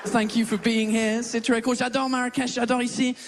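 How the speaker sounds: lightly put-on English accent in French; fake English accent. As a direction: play the Brit who mangles French. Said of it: "cet accent de merde". (faux accent anglais léger)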